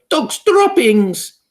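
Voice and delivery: very high, loud, drawn-out cry; witch yell. A narrator's reading. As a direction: yell it across the room. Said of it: "the yell of".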